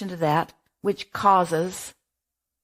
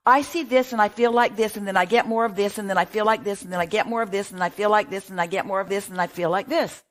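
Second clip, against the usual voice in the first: relentless round-and-round rattle, gradually easing down; looping recitation; faster than usual. Acted. Advisor acts out the parent's endless cycle, rattling it off. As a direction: run the phrases together in a singsong loop.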